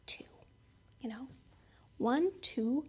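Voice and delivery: hushed, doubtful counting of the chariots; a servant quietly tallying the enemy; counting under breath. Retold or imitated. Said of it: "kinda going like this".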